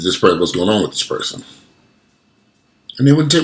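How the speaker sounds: normal talking voice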